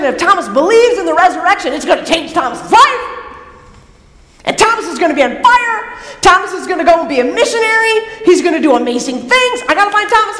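high, loud, racing excitement; excited Jesus. Acted. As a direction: burst with news you can't wait to share. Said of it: "Does it sound a lot more like Jesus".

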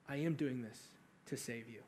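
very soft and low, almost to himself; hushed inner thought. Retold or imitated. Jesus silently answering the criminal from the cross, hushed.

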